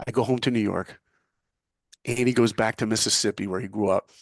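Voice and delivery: ordinary talk, nothing marked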